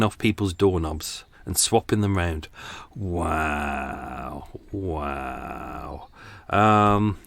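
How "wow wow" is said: low, soft, drawn-out mock amazement; deadpan wonder, sinking in pitch; sarcastic awe. Real, not acted. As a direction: stretch it out, deeply unimpressed. Unimpressed by the pair's silly prank.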